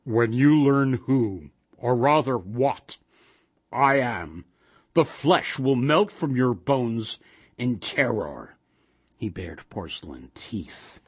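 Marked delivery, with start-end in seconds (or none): 0.0-8.5 slow, menacing golem voice; monotone monster pronouncement; harsh and monotonous. A narrator's reading. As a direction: intone the threat harshly, word by word. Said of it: "His voice was harsh and monotonous".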